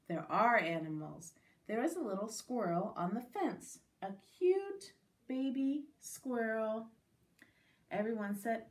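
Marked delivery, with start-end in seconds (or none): none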